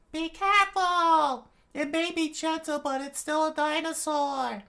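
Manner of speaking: very high shrill warning cry; high scream. Acted. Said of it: "screamed as loud as she could".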